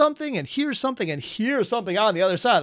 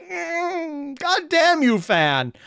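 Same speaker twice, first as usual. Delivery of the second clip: frustrated outburst; 11 semitones higher, much slower than usual. Real, not acted. Reactor crushed by a cliffhanger cursing the author, high-pitched frustration. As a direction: squeal with frustration, then curse.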